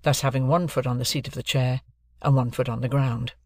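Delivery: ordinary delivery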